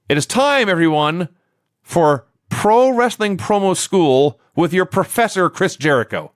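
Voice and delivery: high, slow, grand introduction; playful ring-announcer build, settling on the name; mock announcer. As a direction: announce it like a showman's big segment. Mock announcing a promo class.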